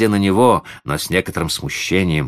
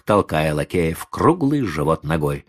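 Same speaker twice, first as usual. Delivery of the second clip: usual speaking voice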